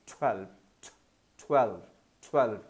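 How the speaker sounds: ट्वेल्व clipped short, ending on a clear v; model twelve. Shown as a demonstration. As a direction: say ट्वेल्व briefly, closing on the v. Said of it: "वी पर ये sound खत्म होता है".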